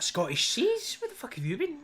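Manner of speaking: high, loud mock disbelief; incredulous granda. Granda retorting to American praising Scottish seas, mock incredulous. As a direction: challenge it, voice leaping up in comic outrage.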